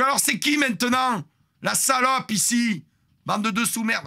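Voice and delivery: high, loud furious insults; yelling insults. Retold or imitated. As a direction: blast them all with abuse. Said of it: "je continue à les insulter".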